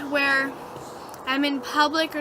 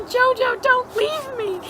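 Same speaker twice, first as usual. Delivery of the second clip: high-pitched pleading whimper, hammed up; mock crying; 8 semitones higher, slower than usual. Dreamer holds dying dog, high mock-tearful plea. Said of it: "I'm crying".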